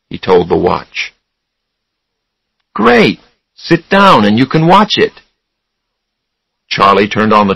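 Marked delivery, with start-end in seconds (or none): 2.7-5.1 upbeat. A narrator's reading. Charlie encourages Raymond to watch.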